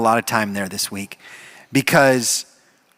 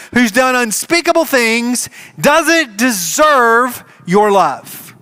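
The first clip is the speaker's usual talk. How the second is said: higher, louder, bitter complaint; bitter resentment; 9 semitones higher, 5 dB louder than usual. Acted. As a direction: spit out the jealous grievance. Older brother's bitter complaint.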